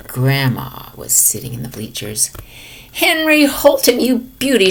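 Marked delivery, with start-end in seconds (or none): 2.9-4.7 louder cheering holler. A narrator's reading. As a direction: yell it from the bleachers. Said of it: "she hollered".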